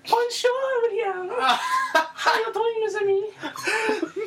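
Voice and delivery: put-on high-pitched, drawn-out French accent; slow, squeaky French greeting through laughter; hammed-up French accent. Podcaster putting on a French girl's accent for laughs, hammed-up and high. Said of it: "can I do the accent?".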